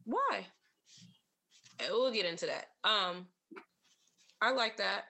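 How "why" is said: ordinary talk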